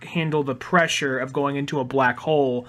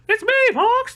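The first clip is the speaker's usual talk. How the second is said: much higher squeaky line; high Slippy voice; 5 dB louder than usual. Retold or imitated. High-voiced Slippy greeting Fox, squeaky. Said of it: "he went from being like".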